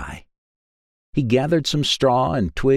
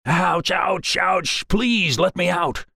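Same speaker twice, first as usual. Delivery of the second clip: high, pained yelps of a scalded wolf; high pained yelping wolf begging to get out; screaming in agony; 9 semitones higher, slower than usual. A narrator's reading. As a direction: yelp it out high, burned and desperate. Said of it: "He screamed in agony".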